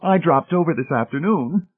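put-on high-pitched, gushing older lady; high, effusive matron. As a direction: explain it sociably in a high, gushing voice.

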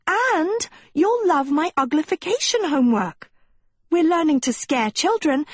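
Sophie, wheedling. Acted. Narrator voices Sophie coaxing Agatha.

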